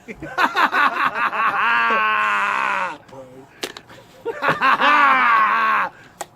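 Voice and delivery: loud laughter, almost crying; loud wheezing laugh; laughing fit. Real, not acted. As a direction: howl with laughter.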